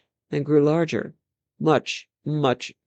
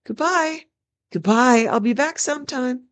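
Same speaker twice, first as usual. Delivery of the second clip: shouted goodbye; 8 semitones higher than usual. Acted. Prince calls goodbye to his old nurse, shouting. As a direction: call out gleefully to the nurse below. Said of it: "waved and shouted".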